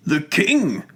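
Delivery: high startled burst on king; astonished outburst. Acted. The patient, stunned that the king is praised, bursts out in astonishment. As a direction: blurt it out, high, not believing your ears. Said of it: "ejaculates Mister Dodsworth".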